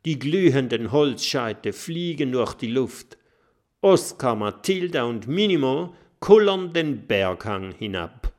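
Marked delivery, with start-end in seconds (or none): none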